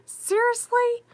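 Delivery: high, incredulous seriously; exasperated. Real, not acted. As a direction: throw up your hands in disbelief. Exasperated at men.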